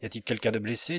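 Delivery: usual speaking voice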